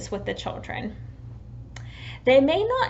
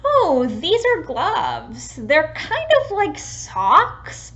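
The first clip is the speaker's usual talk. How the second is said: put-on slow, higher, louder child-directed explaining; teaching a child; 5 semitones higher, 5 dB louder, much slower than usual. Shown as a demonstration. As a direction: explain slowly to a toddler, sing-song. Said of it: "An example of this would be".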